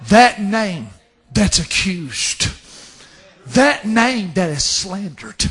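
ordinary talk, nothing marked

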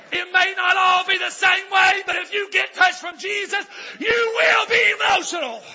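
high, loud preacher's shout; fiery pulpit shout; preaching at full force. Real, not acted. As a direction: drive it home to the congregation. Preaching climax on emotion.

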